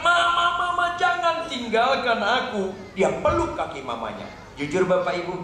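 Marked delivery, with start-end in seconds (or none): 0.0-2.7 high, pleading cry; yelling. Acted. Daughter runs after her mother begging her to stay, pleading yell. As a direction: call after her desperately, pitch raised right up. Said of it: "Dia teriak".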